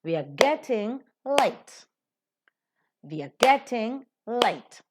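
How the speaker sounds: "getting" and "late" stressed, "We are" weak; slow line leaning on "getting" and "late". Shown as a demonstration. Teacher models the sentence, stressing "getting" and "late". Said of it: "The rest are not".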